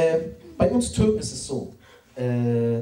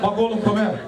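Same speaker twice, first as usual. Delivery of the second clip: stern father-in-law; 4 semitones higher, 4 dB louder than usual. Retold or imitated. Imitating father-in-law Aslan.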